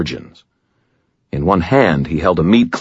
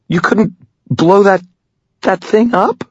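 put-on a much higher, louder girl's voice; doubtful girl; 5 dB louder than usual. Girl challenges the tired wizard. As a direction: press him in disbelief.

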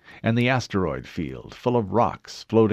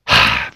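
sharp, loud exhale through the mouth; explosive sigh; 11 dB louder than usual. Acted. Admiral sighing at the news of no weapons, explosive sigh. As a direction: blast out a frustrated sigh. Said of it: "sighed explosively".

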